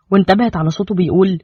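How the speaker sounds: usual speaking voice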